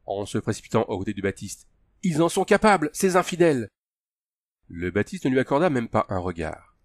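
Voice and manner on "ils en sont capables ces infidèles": urgent, high-pitched plea; imploring. A narrator's reading. Raznar rushes to the Baptiste's side, imploring. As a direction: plead harder, voice pushed up high. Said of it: "implora Raznar".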